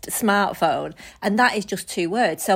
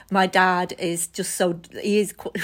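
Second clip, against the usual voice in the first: ordinary talk, nothing marked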